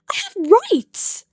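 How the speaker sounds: high, slow outraged protest; high drawn-out cry of indignation; indignant boy. A narrator's reading. Izuku protesting.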